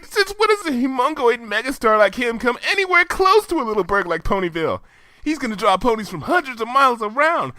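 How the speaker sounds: high, brash, excited pony voice; Rainbow Dash voice. Acted. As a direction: push it high and loud with hype.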